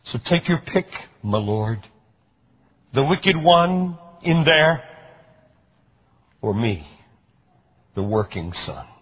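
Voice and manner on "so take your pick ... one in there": bitter outburst. A narrator's reading. Older son's bitter outburst.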